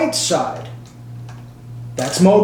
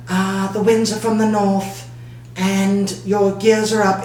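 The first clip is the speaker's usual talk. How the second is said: sexy British voice. Retold or imitated. The radio flattering pilot Brad, light British accent. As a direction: imitate a smooth British talking radio. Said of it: "In a sexy British voice".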